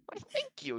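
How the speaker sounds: very high, chirpy thanks; a little owl preening at the compliment; flattered owl. Acted.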